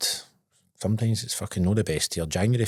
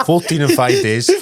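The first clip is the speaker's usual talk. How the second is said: louder, laughing brag; laughing boast; 4 semitones higher, 7 dB louder than usual. Real, not acted. Telling his mate his haul, laughing. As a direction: own the excess, half laughing. Admitting fourteen bottles.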